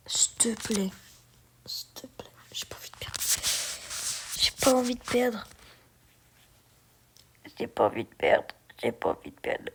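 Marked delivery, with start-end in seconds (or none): 0.1-3.1 whispered pleading, barely audible; whispering while hiding. Real, not acted. 7.6-9.7 whispering while hiding. Real, not acted.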